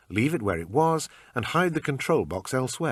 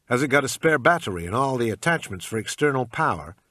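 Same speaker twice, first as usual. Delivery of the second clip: bad American accent; 4 semitones higher, 5 dB louder than usual. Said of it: "in my usual bad American accent".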